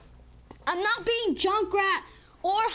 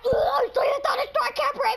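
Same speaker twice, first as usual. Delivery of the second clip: mock choking; 5 semitones higher, faster than usual. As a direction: gasp it out like someone choking. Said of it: "He's like".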